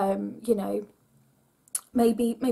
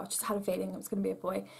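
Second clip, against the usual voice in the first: ordinary talk, nothing marked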